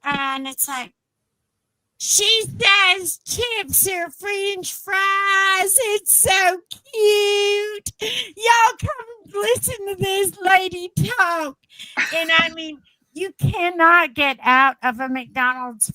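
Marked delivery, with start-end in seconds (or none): 2.0-5.8 very slow, high singsong mock Southern; gushing Southern local. Texan repeats her word for chips, gushing drawl. 5.9-11.6 high, drawn-out mock Southern cooing; gushing Southern local. Texan calls y'all to hear this lady, gushing drawl. Mimics Texans calling others to listen.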